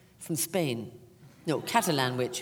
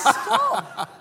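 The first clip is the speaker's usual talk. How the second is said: mock protest; 10 dB louder than usual. Mock-pleading with young cast.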